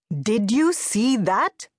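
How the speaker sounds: slow, rising indignation; indignant. A narrator's reading. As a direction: tell your wife what just happened, getting worked up. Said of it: "his voice rising".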